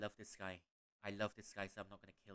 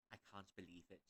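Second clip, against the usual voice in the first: normal talking voice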